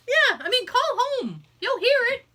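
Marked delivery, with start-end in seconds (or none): none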